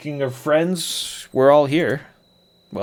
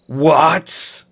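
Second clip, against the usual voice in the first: indignant what; 4 semitones higher, 7 dB louder than usual. Acted. Shamino reacts to teasing.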